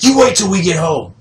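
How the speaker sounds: high, loud threat from an angry dad; imitated yelling dad. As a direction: bellow it as the fed-up father. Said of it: "he was yelling at me".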